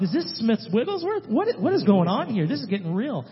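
high, loud retold astonishment; incredulous alarm. Baffled reaction, retold.

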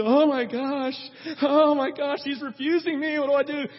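high, loud mock dismay; Mary, distraught mother. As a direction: act out a rejected mother's overreaction. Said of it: "she's to go".

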